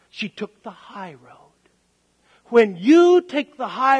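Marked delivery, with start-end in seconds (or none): 0.1-1.5 slow, near-whispered revelation; hushed emphasis. Real, not acted. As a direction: drop to a slow whisper for weight. Hushed punchline of the preacher's story.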